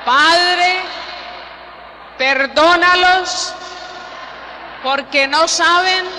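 crying out the words of the cross; loud cry. Shown as a demonstration. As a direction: call it out loud to the whole church. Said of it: "¿No se oye?".

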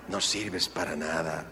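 the wounding words, repeated softly; quiet hurt echo. Shown as a demonstration. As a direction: let "No sirves para nada" sink in quietly. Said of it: "repeated echo".

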